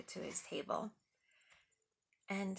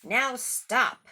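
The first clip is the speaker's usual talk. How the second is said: loud sharp order; stern command; 4 semitones higher, 13 dB louder than usual. Acted. Max stops the wild things, loud and stern. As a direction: stop them dead. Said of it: "Max said".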